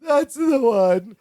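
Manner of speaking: slow, drawn-out weepy imitation; mock sobbing. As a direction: wail it slowly in mock grief. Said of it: "he's crying and sobbing".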